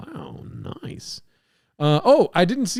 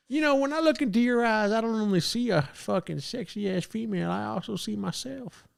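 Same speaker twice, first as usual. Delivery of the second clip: higher, fading softer come-on; Theo Von; 5 semitones higher, 4 dB quieter than usual. Pretend. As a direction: croon a mock-romantic line, trailing off. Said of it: "It's so easy to be Theo Von".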